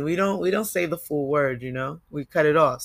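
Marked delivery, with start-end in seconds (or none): none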